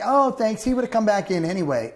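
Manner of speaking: imitating a neighbor in a high voice; blithe neighbors. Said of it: "they'll be like".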